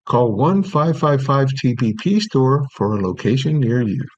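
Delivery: upbeat announcer read; radio ad read. Acted. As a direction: sell it like a pharmacy radio spot. Reads the radio commercial.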